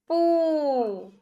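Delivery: पू held long and slow; long drawn vowel. Shown as a demonstration. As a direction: stretch the oo in पू. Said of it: "खींच रहे हैं". (पू को खींचकर लंबा बोलें)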